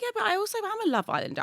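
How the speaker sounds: high, quick chirpy answer; mock chirpy reply. Answering her fans in a chirpy voice. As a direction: toss it back brightly. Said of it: "I'm like".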